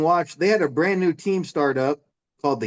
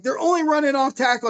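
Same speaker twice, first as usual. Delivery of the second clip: much higher retold shout; yelling from the stands; 11 semitones higher than usual. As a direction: holler it at the sideline. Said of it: "I'm yelling all this from the stands".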